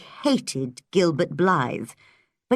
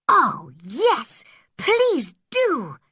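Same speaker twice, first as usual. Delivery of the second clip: high, eager pleading; girl begging Marilla eagerly; excited; 7 semitones higher, much slower than usual. A narrator's reading. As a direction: bubble over with delight. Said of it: "cried Anne excitedly".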